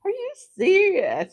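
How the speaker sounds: incredulous driver. Retold or imitated. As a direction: imitate him not believing it. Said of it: "He's like".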